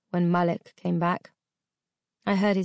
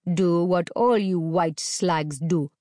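slow menace; slower than usual. A narrator's reading. Captor sneering his order at the girl. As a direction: drag the words out with scorn. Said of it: "captor menacing".

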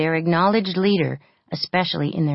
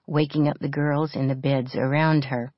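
ordinary delivery, nothing marked

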